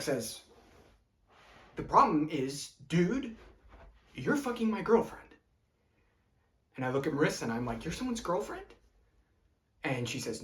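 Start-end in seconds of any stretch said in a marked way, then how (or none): 1.7-5.3 raised, higher-pitched accusing voice; mild outburst of jealous accusation; angry confrontation. Acted. Jealous Chuck confronts the man on the roof, angry accusation. 7.8-8.7 high, incredulous question; surprised question. Acted. Man turns to Marissa after the accusation, surprised question.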